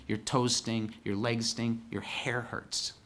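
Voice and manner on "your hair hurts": ordinary delivery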